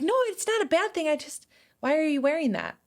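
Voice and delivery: high-pitched imitation of a kid; backpedalling kid. Voices kid backpedalling about eyebrows.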